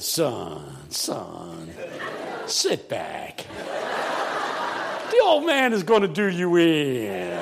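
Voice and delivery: very low, drawn-out elder voice; old pastor. Retold or imitated. Old pastor to younger preacher before his turn, drawled warning. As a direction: imitate an old preacher savoring his challenge. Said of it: "my pastor leaned over. He said".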